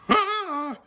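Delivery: sudden high startled cry; frightened yelp. Acted. Narrator frightened by huge eyes.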